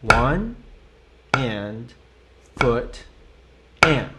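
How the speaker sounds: "one and foot and" with long gaps between words; very slow count. Shown as a demonstration. Teacher showing how slowly a student can go. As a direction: leave a long pause after every word. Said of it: "Even if you have to go this slow".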